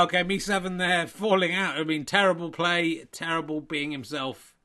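ordinary talk

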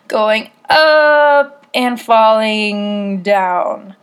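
very slow. Acted. Acting out a slow pitch.

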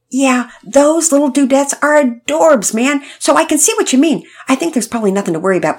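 put-on bouncy young surfer dude, eager and quick at the end; Todd's voice.

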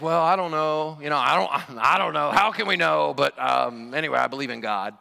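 hesitant, noncommittal mumble with a laugh; hammed-up uncertain shrug; mock wishy-washy hedging. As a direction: play the vague, unsure believer. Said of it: "what you're supposed to say is".

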